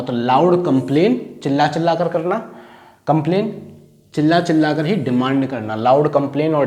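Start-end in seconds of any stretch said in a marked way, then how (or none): none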